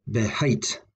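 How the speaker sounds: beHATE with an unstressed be; stress on hate. Shown as a demonstration. Teacher repeating the word for promise, stress on hate. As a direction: put the weight of behate on hate. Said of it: "the stress was on hate".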